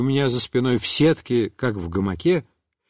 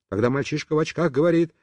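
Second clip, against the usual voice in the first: ordinary delivery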